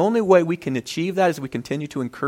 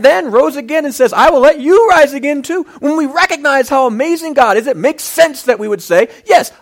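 preaching higher and louder, rising to a plea; full-force preaching; 5 semitones higher, 8 dB louder than usual. Acted. Preacher urging the congregation toward the altar, fervent. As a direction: build the sermon into a fervent altar call.

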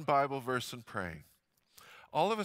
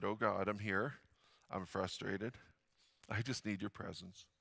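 quiet, softer retold prayer; a soft, half-asleep plea to God; hushed prayer; 4 semitones lower, 7 dB quieter than usual. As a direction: murmur it low like a tired prayer at dawn.

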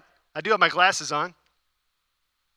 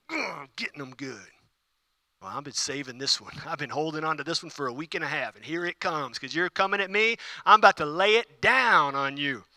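softer, a hint of a spiteful schemer; mock vindictive voice; 4 semitones lower, 5 dB quieter than usual. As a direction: voice the grudge-holder gloating under breath. Voicing someone wielding words vindictively.

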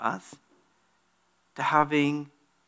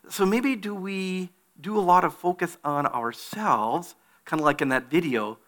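ordinary talk

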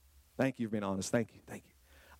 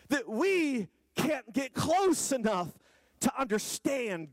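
full-throated pulpit preaching, pitched up high; preaching at full force; 9 semitones higher, slower than usual. Acted. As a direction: climb into a slow, ringing sermon cry.

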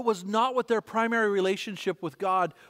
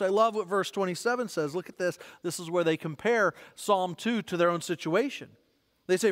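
normal talking voice

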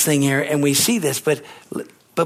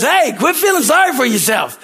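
friend's blunt line; 11 semitones higher, 6 dB louder than usual. Retold or imitated. Friend quoted again.

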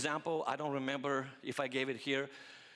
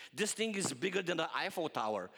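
ordinary talk

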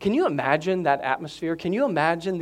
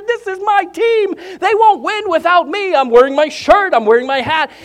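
excited fan; 11 semitones higher, 8 dB louder than usual. Retold or imitated. Fans cheering their home team.

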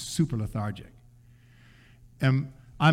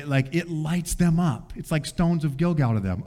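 ordinary talk, nothing marked

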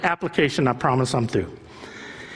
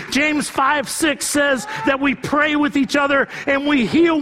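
preaching at full force; 11 semitones higher than usual. Acted. Pastor urging church to embrace authenticity, preaching at full force. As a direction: pour it out like a revival preacher.

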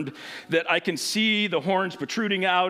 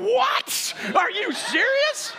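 high, slow cry of disbelief; a stunned kid balking at the dive; shocked disbelief; slower than usual. Retold or imitated. Boy told to jump off the high dive, high shocked cry.

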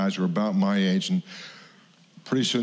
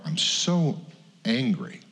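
ordinary talk, nothing marked

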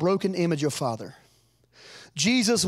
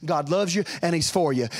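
ordinary delivery

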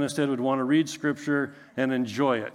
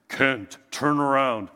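lower, solemn voice from above; voice of God; 4 semitones lower, slower than usual. Pretend. God calling the preacher back, low and solemn. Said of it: "I hear this".

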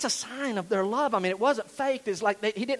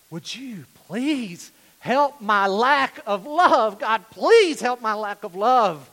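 loud, drawn-out begging; supplication; 2 semitones higher, 7 dB louder, much slower than usual. Shown as a demonstration. Preacher supplicating God for love, loud drawn-out plea. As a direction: plead slowly, leaning on "please". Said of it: "Have you ever supplicated".

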